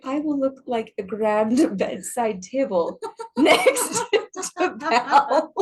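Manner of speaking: giggling through the words; laughing. Retold or imitated. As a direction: laugh while you say it. Joking about looking like furniture.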